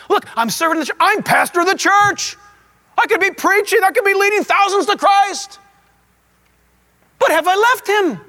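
a high-pitched plea, mildly acted; lightly voiced self-justifying churchman; defensive busy pastor. Busy pastor defending his service to God, mildly indignant.

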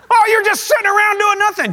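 high, whiny mock indignation; hammed-up resentful moan; wounded-pride complaint. Busy Martha accusing her idle sister, whining indignantly. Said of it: "does that strike the nerve of pride".

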